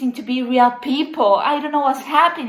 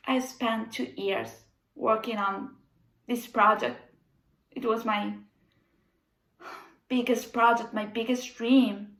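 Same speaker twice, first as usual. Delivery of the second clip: lamenting; 3 semitones lower, 7 dB quieter than usual. Acted. Lamenting her ruined project.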